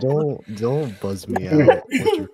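laughing. Real, not acted. Laughing at the buzzing sound.

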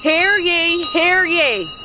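booming proclamation call; town crier. Acted. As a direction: announce it like a crier. Town crier opens council meeting.

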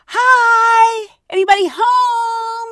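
high, loud hello into emptiness; calling out. Retold or imitated. Tourist calling at the empty gate shack, loud hopeful call. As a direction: call out to whoever might be inside.

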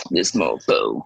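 low, muttered scorn under the breath; deep, disgusted aside; muttered disdain. Retold or imitated. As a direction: grumble it in contempt. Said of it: "I'm just like".

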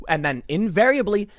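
normal talking voice

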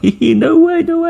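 very high, giggly mock squeal; imitated laughing disbelief. Local women laughing at his answer, high mocking giggle. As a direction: mimic women cackling in disbelief. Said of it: "they're just laughing".

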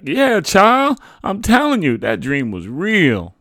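high, loud, insistent yell; yelling back. A narrator's reading. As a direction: yell it back to win over a doubter. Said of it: "my mother yelled back".

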